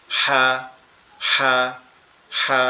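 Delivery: "হা" with a hissing rasp from the throat; raspy throat ha. Shown as a demonstration. Teacher shows a raspy "হা", like a hissing duck. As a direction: breathe a raspy "হা" like a goose. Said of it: "ফ্যাস ফ্যাসা আওয়াজের মতো".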